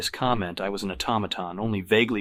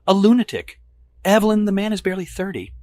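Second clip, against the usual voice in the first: loud, much higher scoff; high scoffing woman's voice; 12 semitones higher, 8 dB louder than usual. Acted. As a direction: scoff at him. Evelyn scoffing.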